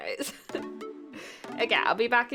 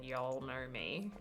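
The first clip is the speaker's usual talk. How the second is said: put-on southern accent; 6 semitones lower, 9 dB quieter, slower than usual. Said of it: "learn to say something other than".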